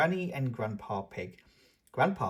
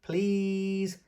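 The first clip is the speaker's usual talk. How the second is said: pleading Peppa; 6 semitones higher than usual. Acted.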